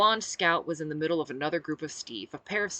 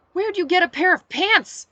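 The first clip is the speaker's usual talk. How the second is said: loud, high-pitched acted demand; indignant demand; 14 semitones higher, 12 dB louder than usual. A narrator's reading. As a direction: demand it loudly, pitch jumping up. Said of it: "I demanded".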